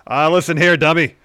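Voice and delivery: loud, high-pitched cocky wrestler voice; L A Knight. Acted. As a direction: boom it out like a wrestler cutting a promo. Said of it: "conversation between L A Knight".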